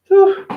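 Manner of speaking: high, loud puff of relief from the heat; a sharp, high "whew" of overheating; hot whew. Real, not acted. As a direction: blow out the heat. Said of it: "It's really warm in the bathroom".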